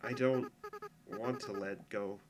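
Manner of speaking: fading sad whisper-like. Acted. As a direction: trail off as if holding back tears. Breaking off admission of not letting go.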